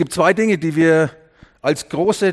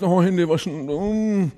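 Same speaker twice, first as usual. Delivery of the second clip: hammed-up grumbling hesitation; grumble, then hum in mock indecision; mock reluctance; much slower than usual. Invited guest balks at washing hands, mock reluctant.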